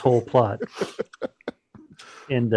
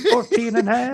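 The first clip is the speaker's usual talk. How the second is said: put-on Southern accent; 10 semitones higher than usual. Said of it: "doing southern accents".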